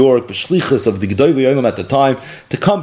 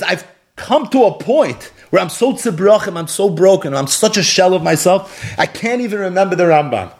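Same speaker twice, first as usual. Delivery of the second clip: anguished; 7 semitones higher than usual. Retold or imitated. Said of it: "the pain and the anguish".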